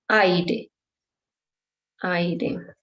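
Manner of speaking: aire stressed on the a; stress on first syllable. Shown as a demonstration. Teacher shows where aire is stressed. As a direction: lean on the a of aire. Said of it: "right away in the first syllable".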